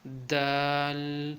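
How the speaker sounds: slow دال with a held final l; slightly stretched. Shown as a demonstration. Teacher showing how to stretch it, long دال. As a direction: draw out دال, "daaalll". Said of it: "آخر میں لام کی آواز آئے گی".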